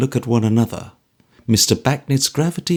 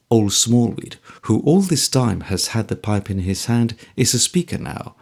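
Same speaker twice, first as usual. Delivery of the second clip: ordinary delivery, nothing marked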